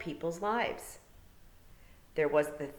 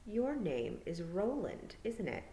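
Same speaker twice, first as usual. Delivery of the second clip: quiet; 3 semitones higher, 7 dB quieter, slower than usual. A narrator's reading. Tiffany quiet realization.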